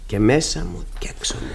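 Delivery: low, soft whisper. Acted. Mrs Alving confessing to the pastor her inner ghosts, low whisper. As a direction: breathe it out as a private dread.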